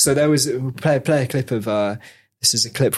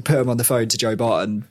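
ordinary talk

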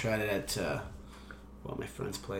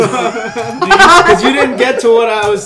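protesting through high laughter; laughing; 8 semitones higher, 10 dB louder, slower than usual. Real, not acted. Podcast host laughing while explaining his joke. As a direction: laughs out the excuse.

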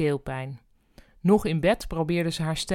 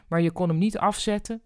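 plain reading voice

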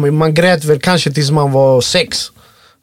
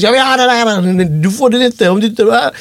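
a hint of a bratty kid demanding, pitched up; lightly voiced cheeky child wanting a cookie; cocky little daughter; 6 semitones higher than usual. Father imitating his daughter in the shop, mildly cocky. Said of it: "på lite kaxig vis".

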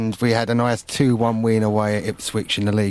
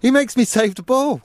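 whiny goalkeeper; 11 semitones higher than usual. Retold or imitated. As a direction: whinge like a put-upon keeper. Goalkeeper voiced complaining to chairman.

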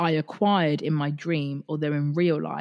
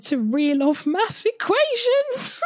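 laughing squeal; 3 dB louder, slower than usual. Real, not acted.

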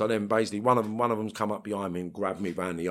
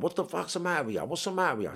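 much higher, sharp demand at his wife; retold snap; 9 semitones higher, 3 dB quieter, faster than usual. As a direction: bark it at her, baffled. Confronting hysterical wife.

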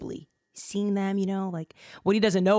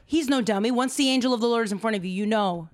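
ordinary talk

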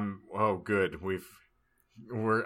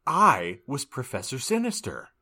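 ordinary talk

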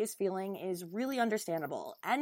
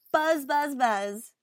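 playful buzzing; 4 semitones higher, 5 dB louder, much slower than usual. Pretend. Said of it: "podcast sign-off buzzing".

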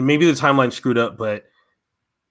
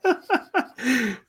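embarrassed laugh; 13 semitones higher, 4 dB quieter than usual. Real, not acted. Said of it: "embarrassed laugh before impression".

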